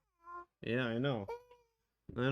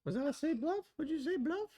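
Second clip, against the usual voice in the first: high squeaky voice. Pretend. Mock chat voice reading.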